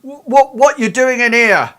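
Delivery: high, loud challenging guard voice; suspicious guard. Acted. A security guard confronts the twin by the bed, high and loud. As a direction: demand it like a guard who caught an intruder. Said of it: "he says".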